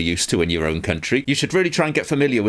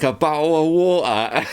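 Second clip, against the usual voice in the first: slow, laughing "bowl of water" with glottal stops; mock glottal British accent; 6 semitones higher, slower than usual. Shown as a demonstration. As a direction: drop the t's in "water", "wa'er". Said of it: "spell it phonetically like that".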